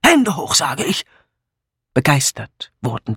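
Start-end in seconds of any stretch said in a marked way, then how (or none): 0.0-1.0 gruff hold-up command; hoarse snarling man. A narrator's reading. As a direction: snap it like a hoarse gunman. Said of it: "eine heisere Männerstimme ... schnauzte". (heiser geschnauzter Befehl)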